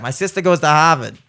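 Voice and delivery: "My sister goes to Harvard" with dropped r's; Boston accent. Shown as a demonstration. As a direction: say it like a Boston local. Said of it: "I can do that".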